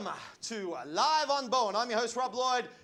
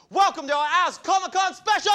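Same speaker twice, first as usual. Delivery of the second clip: excited shout; 9 semitones higher, 10 dB louder than usual. Acted. TV host opening shout.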